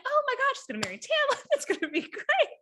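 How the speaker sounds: way up high, thrilled, giggling at the end; gushing excitement. Retold or imitated. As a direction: squeal it like a fan who can't wait for the wedding. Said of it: "I was like".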